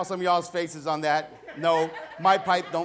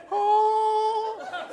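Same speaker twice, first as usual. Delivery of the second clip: awed gasp; 4 dB louder than usual. Retold or imitated. Son awed walking into Panda Express.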